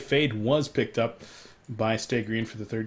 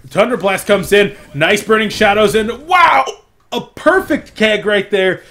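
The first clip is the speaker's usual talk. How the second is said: loud, rising esports play-by-play; excited play-by-play; 5 semitones higher, 11 dB louder than usual. Acted. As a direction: build the hype call by call. Live play-by-play of a fight.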